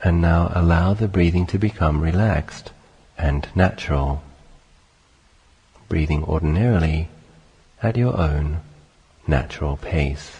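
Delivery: calm, unhurried meditation voice with long pauses; guided meditation. Acted. Teacher leading listeners through sitting and breathing, calm guided meditation. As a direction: guide listeners gently into stillness, phrase by phrase.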